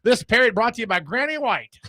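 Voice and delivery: high, hammed-up vintage sponsor read; a retro radio voice reading the sponsor; old-time announcer. Pretending a 1930s broadcast sponsor plug, high vintage announcer voice. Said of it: "nineteen mid-thirties announcer".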